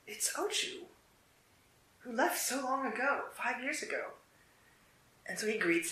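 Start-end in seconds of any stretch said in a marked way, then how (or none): 0.1-4.1 high, airy exclamation of surprise; surprised greeting. Acted. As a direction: gasp it out, higher, caught off guard. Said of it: "Chokan's surprised to see him".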